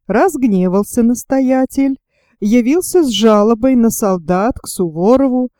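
ordinary delivery

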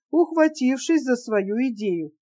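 ordinary delivery

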